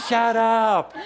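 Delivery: high, loud mock outburst; playful shout. Teacher teasing the audience, shouting playfully. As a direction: burst out with it, loud and high, in fun.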